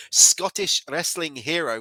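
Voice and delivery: put-on a clumsy stab at a Scottish accent; hammed-up Scottish accent, half-joking; bad Scottish accent. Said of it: "That was a really bad Scottish accent".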